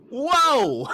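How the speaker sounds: high loud startled whoa. Retold or imitated. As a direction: yelp in surprise at the coyote. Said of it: "I was like".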